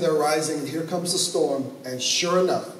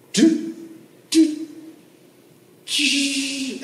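high sung beeps; imitated beeps; 10 semitones higher, much slower than usual. Preacher mimicking cockpit beeps while landing, high. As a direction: beep like cockpit instruments.

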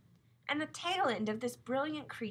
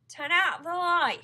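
slow, louder call across the dark room; 7 semitones higher, 11 dB louder, slower than usual. Acted. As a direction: call it out, higher and slower, to be heard. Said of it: "he called, raising his voice".

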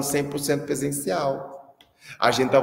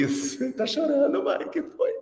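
mock sweet voice; 12 semitones higher, slower than usual. Mock comforting crying mother.

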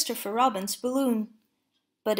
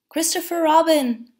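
higher, louder call to a friend below; raised-voice storybook call; 6 semitones higher, 7 dB louder than usual. A narrator's reading. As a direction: call out the name from up high. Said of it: "Pooh called down".

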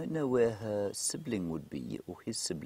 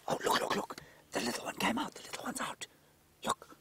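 breathless Australian-free whisper of delight, rising high; hushed thrill as the cub pops out; excited whisper. Real, not acted. Guide whispering to viewers as the cub comes out, excited whisper.

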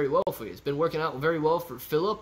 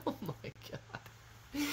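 whispered gasp; 10 semitones higher, 16 dB quieter, much slower than usual. Real, not acted. Reacting to eating keyboard.

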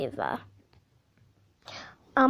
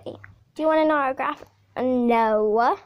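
ordinary talk, nothing marked

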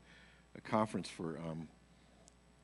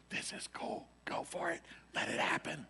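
whispered encouragement; 7 semitones higher, 3 dB quieter than usual. Retold or imitated. Pianist eggs him on quietly.

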